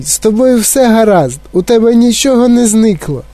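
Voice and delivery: high, anxious motherly outburst; exclaiming. A narrator's reading. Mother checking her son is intact, exclaiming. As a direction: pitch up with urgent concern. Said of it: "вигукнула пані Т В".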